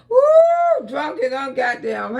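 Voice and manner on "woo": high loud whooping cry; delighted whoop. Real, not acted. Auntie whooping at her live viewers in delight.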